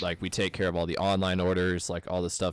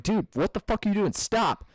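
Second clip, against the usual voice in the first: high, loud mock yell to stop the driver; retold yell; 11 semitones higher, 4 dB louder than usual. Yelling at driver backing toward forklift.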